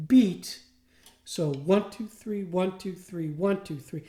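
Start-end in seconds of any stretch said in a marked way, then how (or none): 1.5-4.0 steady triple-feel count with a light lean on "one"; counting in threes. Shown as a demonstration. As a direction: count "one, two, three" in even groups, leaning slightly on each "one". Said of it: "gonna have a slight accent".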